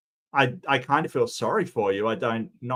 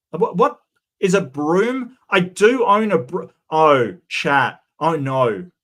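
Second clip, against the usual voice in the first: mock alarm; 2 semitones higher, 6 dB louder, slower than usual. Real, not acted. Realises she owns a broom.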